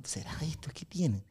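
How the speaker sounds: hushed, breathless whisper of a puzzled judge. Retold or imitated. Judge whispering to another judge about the crying boy. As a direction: whisper it under your breath.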